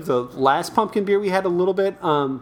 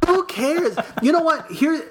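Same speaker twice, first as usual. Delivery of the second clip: laughing; 12 semitones higher than usual. Real, not acted. Dismissive mockery, laughing.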